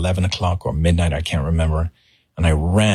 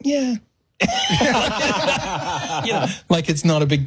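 Michael's casual yeah; 11 semitones higher than usual. Retold or imitated. Michael shrugging it off to a fan, high and light. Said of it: "He goes".

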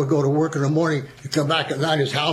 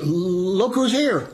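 stuttered; 4 semitones higher, much slower than usual. Retold or imitated. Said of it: "I stuttered a little bit".

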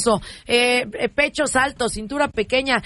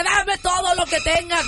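high slow demand; 7 semitones higher than usual. Acted. Wife demands.

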